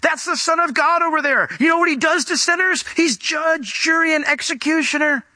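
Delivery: high-pitched, urgent mock warning; demon warning. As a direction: panic the man in a high voice. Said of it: "The demon had told him".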